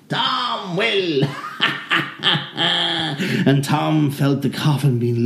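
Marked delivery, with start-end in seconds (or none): 0.1-1.3 high gleeful imp reply; high demon voice. Acted. Demons answer. 1.3-3.4 breathy high impish giggle; high demon cackle. Acted. Demon laughs in story.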